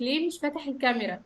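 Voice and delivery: ordinary talk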